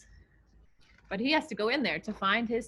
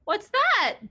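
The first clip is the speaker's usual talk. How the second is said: startled; 9 semitones higher, 5 dB louder than usual. Acted. Startled by something in woods.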